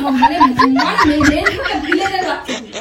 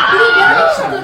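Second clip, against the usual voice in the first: much higher, louder laughing yell; wife yelling at husband, half laughing; laughing shout; 9 semitones higher, 8 dB louder than usual. Acted. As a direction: blast the question at him. Wife repeats question, escalating.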